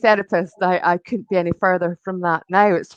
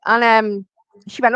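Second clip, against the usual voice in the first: ordinary talk, nothing marked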